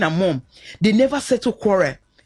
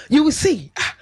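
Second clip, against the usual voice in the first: usual speaking voice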